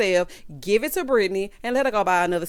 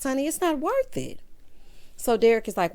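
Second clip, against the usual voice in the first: ordinary talk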